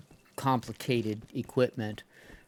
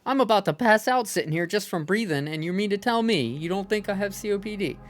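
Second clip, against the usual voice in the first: high-pitched, exasperated disbelief; indignant; 8 semitones higher, 3 dB louder than usual. Retold or imitated. Patient disputing the doctor's diagnosis, indignant. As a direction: protest it as if confronting the doctor.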